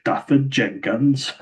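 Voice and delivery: "Daffyd Jenkins" in a mock Welsh lilt, high and slow with a rise; Welsh accent. Shown as a demonstration. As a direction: stretch "Daffyd Jenkins" slowly in a sing-song Welsh accent. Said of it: "I can't say his name without a Welsh accent".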